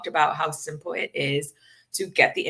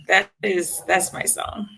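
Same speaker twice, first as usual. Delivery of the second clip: ordinary talk, nothing marked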